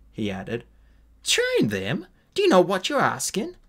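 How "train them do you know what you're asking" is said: incredulous. Acted. Incredulous at Flamel's request.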